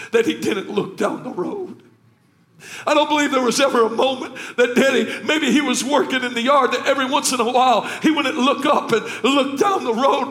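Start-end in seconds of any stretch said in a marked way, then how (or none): 2.9-10.3 high, loud, building sermon cry; full-force preaching. Acted. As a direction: preach it up to a shouted climax.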